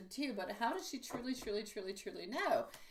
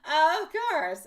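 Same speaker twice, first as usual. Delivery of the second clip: laughing; 8 semitones higher, 13 dB louder than usual. Real, not acted. Laughing reply to viewer comment.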